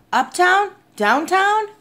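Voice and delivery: high, rising questions; urgent questioning. A narrator's reading. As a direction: throw out options urgently. Boy asks where to go.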